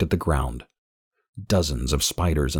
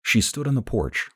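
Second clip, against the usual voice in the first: ordinary delivery, nothing marked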